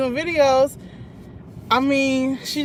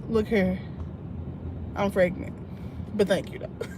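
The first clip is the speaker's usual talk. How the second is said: mock sassy reply; 5 semitones lower, 10 dB quieter than usual. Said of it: "she was like".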